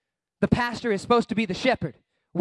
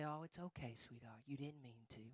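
very low, hushed soothing; mock-tender murmur; coddling reassurance; 11 semitones lower, 23 dB quieter than usual. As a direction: soothe them like a little child. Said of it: "pat him on the back and say".

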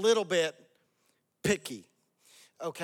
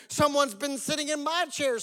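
high storybook bear voice; Mama Bear; 8 semitones higher than usual. A narrator's reading. Mother bear finds her chair used, high voice. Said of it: "said the Mama Bear".

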